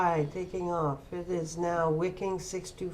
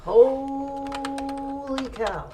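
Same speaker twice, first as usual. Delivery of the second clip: surprised; 8 semitones higher, 6 dB louder, much slower than usual. Real, not acted. Shocked reaction to stock move.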